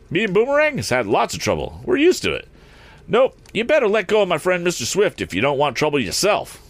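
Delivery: high, animated folksy driver; cheerful. A narrator's reading. Eradicate tells Morse to let go, cheerful. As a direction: breezily stand your ground in a high voice. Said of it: "answered Eradicate cheerfully".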